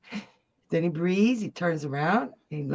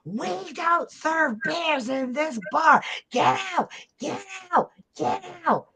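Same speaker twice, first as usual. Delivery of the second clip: high-pitched acted screech; screaming woman; 8 semitones higher, slower than usual. Woman throwing a bear out of the bar, screaming. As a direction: screech it in a raised, outraged pitch. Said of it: "this woman comes running up screaming".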